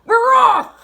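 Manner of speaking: very high, loud cheer; rousing crew shout. A narrator's reading. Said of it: "we all shouted".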